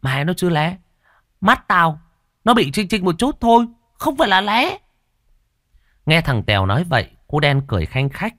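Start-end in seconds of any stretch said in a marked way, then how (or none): 0.0-4.8 put-on much higher, peeved boyish protest; boy grumbling at his friend about his squint, higher and peeved. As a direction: grumble back like a touchy kid. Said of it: "Thằng Tèo Lé lầu bầu".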